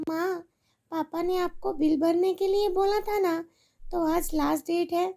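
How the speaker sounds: put-on a little girl begging her mother for help; pleading little girl. Daughter reminds mother.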